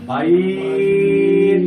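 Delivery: "বাইর" chanted loud with a very long middle vowel; madd at a stop. Shown as a demonstration. Teacher modelling a ya of leen before stopping, "বাইর" drawn out. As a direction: hold "বাইর" on one steady note. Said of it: "আমাকে এক আলিফ মাদ করতে হবে".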